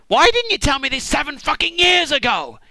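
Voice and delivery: high, loud, outraged cry; exasperated yell. Acted. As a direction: demand it at full volume. Jacob yells at Laban.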